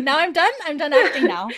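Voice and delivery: put-on high, giggly toddler voice; high laughing kid; little kid voice. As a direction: chirp it like a proud little boy, laughing through it. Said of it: "the little kid being like".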